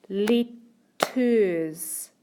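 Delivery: "letters" split in two with a gap; deliberate syllable-by-syllable "letters"; broken into syllables. Shown as a demonstration. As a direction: say "letters" as two separate clapped beats, let, ters. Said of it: "sounds that we can hear in each syllable".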